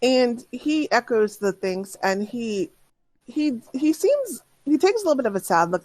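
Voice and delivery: ordinary talk, nothing marked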